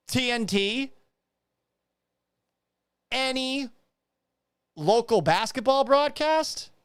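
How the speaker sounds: high, drawn-out questions; incredulous questioning. Real, not acted. Host challenging her broadcasting credits, incredulous. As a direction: call out each one as if searching in vain.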